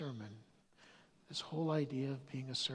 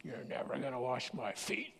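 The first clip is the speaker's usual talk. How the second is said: indignant; 4 semitones lower, 5 dB quieter than usual. Retold or imitated. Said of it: "He got all indignant".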